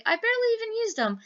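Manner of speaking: high, fast imitation of the seller; quoting the seller. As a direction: toss it off high and quick like the seller.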